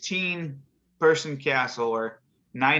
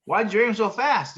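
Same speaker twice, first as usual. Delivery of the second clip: bartender asks; 9 semitones higher, 4 dB louder than usual. Retold or imitated.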